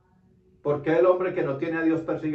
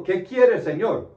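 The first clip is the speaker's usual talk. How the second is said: higher, louder startled question, acted out; mock-alarmed cry to the Lord; startled, frightened question; 6 semitones higher, 6 dB louder, slower than usual. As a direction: act out Saul's fright, voice jumping up. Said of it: "Asustado dijo".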